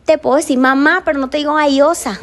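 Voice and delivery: ordinary delivery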